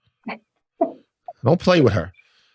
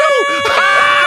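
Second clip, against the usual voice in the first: whale impression; 4 dB louder than usual. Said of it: "your best humpback whale impression".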